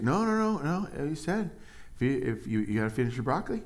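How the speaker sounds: high no, no, then fast and firm; lightly voiced parent refusing; a hint of a stern parent. Parent refusing a child ice cream, high then fast.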